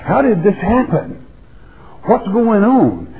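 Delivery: astonished townsfolk asking each other what happened; amazed crowd. Acted. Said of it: "saying one to another".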